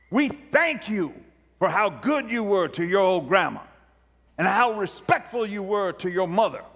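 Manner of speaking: fervent preacher addressing the dead; preacher thanking the dead boy; preaching to the casket. Retold or imitated. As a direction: plead and praise like a pulpit preacher.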